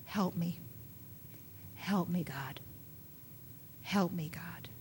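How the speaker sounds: soft, unvoiced whispered prayer; whispered plea. Preacher voicing his daily cry to God, whispered plea. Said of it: "I cry out to God".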